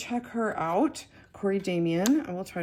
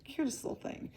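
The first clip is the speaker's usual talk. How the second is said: "cutest little thing" whispered, higher; 8 semitones higher, 6 dB quieter than usual. Real, not acted. Fond repeat of cute figure.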